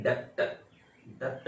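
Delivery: डेब्ट with the b sounded; wrong pronunciation. Shown as a demonstration. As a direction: say the mistaken "DEBT" voicing its b. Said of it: "एक word नहीं है". (डेब्ट का गलत उच्चारण, "ब" सुनाते हुए)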